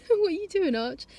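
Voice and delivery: high, sing-song voice for the dog; high-pitched dog talk. Real, not acted. Owner to her dog Archie, in high-pitched dog talk. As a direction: coo at the dog.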